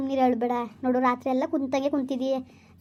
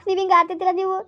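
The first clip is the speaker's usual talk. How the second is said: louder, fast furious rebuke; angry scolding; 3 semitones higher, 4 dB louder, faster than usual. Acted. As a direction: lash out at the husband. Wife scolds husband over lost child. (ಗಂಡನನ್ನು ಕೋಪದಿಂದ ಜೋರಾಗಿ ಬೈಯುವುದು)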